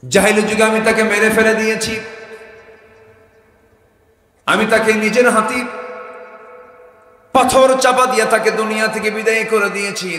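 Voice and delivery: loud, high chanted confession; full-force preaching. Acted. Companion confessing a killing to the Prophet, full-force preaching chant. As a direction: belt each phrase high, then pause.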